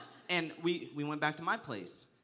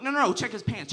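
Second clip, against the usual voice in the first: mock eager priest; 6 semitones higher, 7 dB louder than usual. Priest voiced in story.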